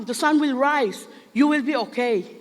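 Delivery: ordinary talk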